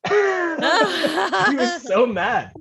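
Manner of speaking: high, breaking up with laughter; laughing. Real, not acted. Driver amused by teammate's outburst, laughing. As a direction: laugh your way through the words. Laughs after own impression.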